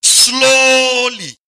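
loud call-out, vowels stretched long; very slow, drawn out. Shown as a demonstration. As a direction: lead the crowd, stretching each word. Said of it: "Everybody say".

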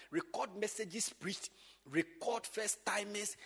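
ordinary talk

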